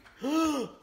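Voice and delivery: high sharp imitated gasp; mock gasp. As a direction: gasp like waking from a nightmare. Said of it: "she just remembers".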